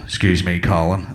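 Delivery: low-pitched, firm interruption; imitated deep-voiced stranger; looming stranger's voice. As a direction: address them from behind in a deep voice. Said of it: "I just hear this voice go".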